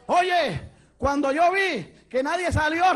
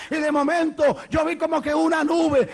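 raised, fervent sermon shout; full-force preaching; 6 semitones higher, 3 dB louder than usual. Real, not acted. Preacher proclaiming God's plan to the congregation, full-force preaching. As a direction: preach it out, stretching the big endings.

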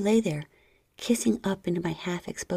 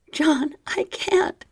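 high slow whimper; 9 semitones higher, 5 dB louder, much slower than usual. Acted. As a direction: whimper it out slowly. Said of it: "I whimpered".

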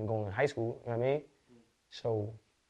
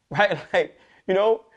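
laughing; 9 semitones higher, 9 dB louder, slower than usual. Real, not acted. Laughs after his story.

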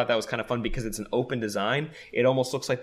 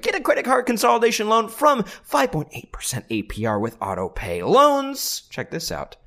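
high, loud infomercial pitch; hammed-up ad read; 11 semitones higher, 4 dB louder than usual. Host reads the sponsor ad to listeners, hammed up. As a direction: sell it like a cheesy TV ad.

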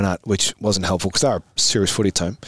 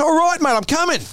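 very high strained call back; acted holler back. Player answering the second yell, hollering back. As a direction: call back pitched right up, harried.

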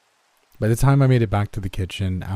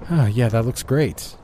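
sarcastic; 3 semitones higher, 3 dB louder than usual. A narrator's reading. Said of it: "rolling my eyes".

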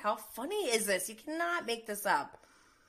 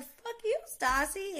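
much higher pitched mock retort; Stassi firing back; 12 semitones higher than usual. Vlogger imitating Stassi's comeback to Tom, high mock snap. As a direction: throw it straight back in a higher voice. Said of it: "And she's like".

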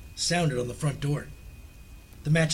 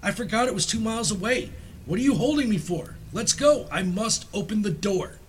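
put-on high, agitated old woman demanding release; crying out, struggling; 6 semitones higher than usual. A narrator's reading. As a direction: plead urgently at a high pitch, struggling to get free. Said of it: "she cried, struggling mechanically".

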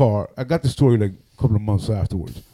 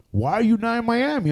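puzzled question; 12 semitones higher than usual. Retold or imitated. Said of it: "He goes".

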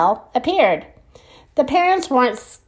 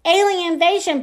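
lightly voiced dad; 8 semitones higher than usual. Father admits his mistake.

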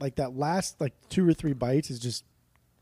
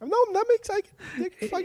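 much higher squeaky voice; high silly voice. Acted. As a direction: pitch way up for a silly bit. Trying a silly voice.

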